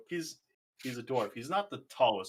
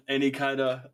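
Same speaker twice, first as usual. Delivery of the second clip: ordinary talk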